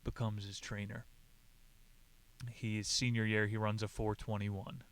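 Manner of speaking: ordinary delivery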